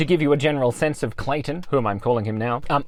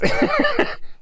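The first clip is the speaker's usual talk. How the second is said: high-pitched giggle burst; high squeaky laugh. Real, not acted. Laughs at own joke.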